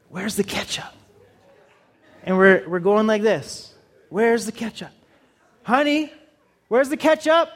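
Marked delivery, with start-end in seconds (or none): 0.1-0.9 soft, distant mumble into the fridge; husband muttering. Retold or imitated. 4.1-7.5 rising to a high call of honey; helpless husband. Retold or imitated. Husband calling to his wife.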